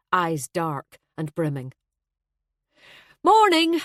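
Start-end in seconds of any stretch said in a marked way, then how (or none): 3.2-3.8 high, loud, cheery hello; brightly called, pitched well up; bright greeting. A narrator's reading. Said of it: "Maeve said brightly".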